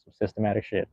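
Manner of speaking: usual speaking voice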